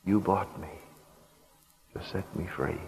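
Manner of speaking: tearful devotion. A narrator's reading. Freed woman offering her life to her buyer, tearful and hushed. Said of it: "through her tears she looked up".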